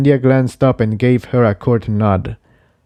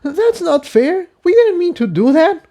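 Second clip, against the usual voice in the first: much higher, hurt protest; higher filly voice, horrified; Tiptoe, horrified. Acted. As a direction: protest through the shock.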